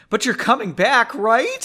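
high, loud, pleading question; anxious, needy appeal; almost desperate. A narrator's reading. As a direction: plead for reassurance, pitch climbing. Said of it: "he asked almost desperately".

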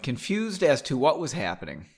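usual speaking voice

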